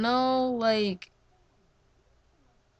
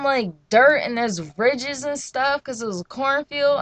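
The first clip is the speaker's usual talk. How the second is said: ordinary delivery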